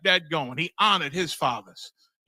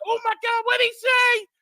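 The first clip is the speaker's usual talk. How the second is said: high mock-horrified shriek; hammed-up gasp of outrage, much higher and louder; scandalized shriek; 3 dB louder than usual. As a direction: shriek it like an offended churchgoer. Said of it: "And they said".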